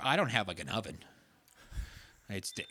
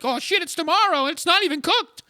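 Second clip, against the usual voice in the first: panicked customer; 11 dB louder than usual. Retold or imitated. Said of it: "'cause you're like".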